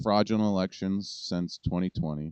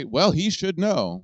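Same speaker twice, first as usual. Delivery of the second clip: higher, louder and slow, like a smug know-it-all; mock knowing remark; 10 semitones higher, 9 dB louder, slower than usual. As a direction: drag it out as if it were obvious. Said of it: "people that you would say".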